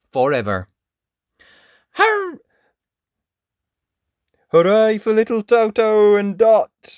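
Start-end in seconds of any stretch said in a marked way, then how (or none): 1.9-7.0 louder, higher hooray; celebrating victory over witch; cheering. Acted. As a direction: cheer the heroes.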